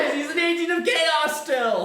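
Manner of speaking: high, loud, slowed yell; shouting. Real, not acted. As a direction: yell it back over the noise. Shouting back in the uproar.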